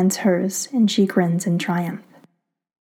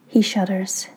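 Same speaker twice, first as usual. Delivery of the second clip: ordinary delivery, nothing marked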